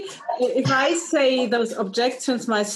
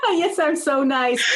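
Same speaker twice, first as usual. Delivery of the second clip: laughing, drawn-out higher voice; playfully nice; 4 semitones higher, slower than usual. Pretend. Interviewer joking about being so nice, laughing playfully. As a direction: play along through a laugh, slowly.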